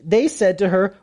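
normal talking voice

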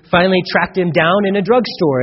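usual speaking voice

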